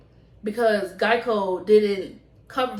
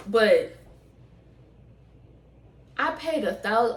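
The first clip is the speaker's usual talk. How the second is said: normal talking voice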